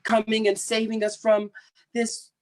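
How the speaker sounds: high, thin put-on voice, gushing thanks; mock grateful Iraqi voice. Speaker mimics Iraqi protesters thanking the US, high mock voice. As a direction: play up the gushing gratitude, pitched well up. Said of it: "And they were".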